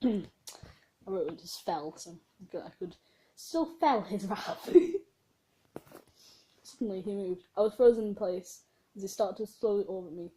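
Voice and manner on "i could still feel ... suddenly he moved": ordinary delivery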